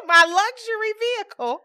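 mock outrage. Scolding the stranger by her car, high loud mock outrage. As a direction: drag it out like the cheek is unbelievable.

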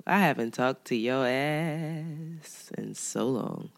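low, slow and croaky; hoarse from being sick. Real, not acted. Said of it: "Do you hear this voice I have?".

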